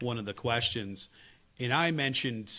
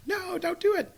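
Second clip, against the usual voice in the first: daughter's squeal; 4 dB louder than usual. Retold or imitated. Daughter teasing before tickling.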